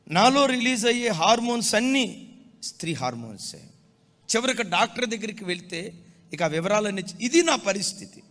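loud, high pulpit proclamation; fervent sermon voice; full-force preaching. Acted. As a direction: preach it out to the congregation.

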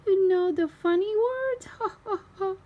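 high, laughing question; laughing Daddy. A narrator's reading. Daddy Pig laughs about the funny words. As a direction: chuckle through it.

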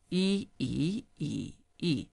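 E in all four tones in turn. Shown as a demonstration. Teacher runs through all four tones. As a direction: run E through flat, rising, dipping, falling. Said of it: "All four tones".

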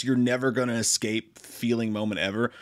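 ordinary talk, nothing marked

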